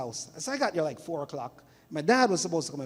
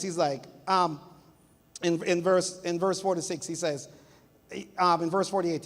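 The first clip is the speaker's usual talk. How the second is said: usual speaking voice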